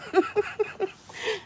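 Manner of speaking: high, giggly laugh; nervous laugh while staying away from the spider. Real, not acted. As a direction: giggle anxiously. Laughing at her fright.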